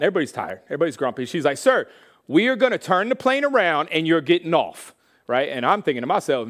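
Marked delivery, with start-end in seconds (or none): 1.6-4.9 raised, louder mock scolding; imitated freaked-out stewardess. Stewardess threatens a passenger on a delayed plane, exasperated and loud. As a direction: lay down the law as a fed-up stewardess. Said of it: "the stewardess is freaking out".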